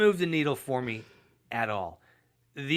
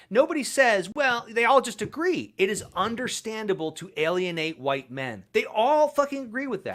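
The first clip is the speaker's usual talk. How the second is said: rising into an outraged, much higher rant; getting more and more worked up, pitch climbing; upset; 9 semitones higher than usual. Real, not acted. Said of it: "that's why I get so upset with these people".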